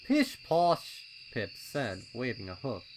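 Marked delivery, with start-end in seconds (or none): 0.0-1.0 high, loud, impatient colt voice; a keen little colt itching to go; eager young colt. A narrator's reading. Pipsqueak urging his friends at the trail, high and loud.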